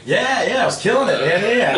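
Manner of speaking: high, loud enthusiastic yes; excited agreement. Real, not acted. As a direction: jump in exuberantly to confirm. Enthusiastic answer to host's question.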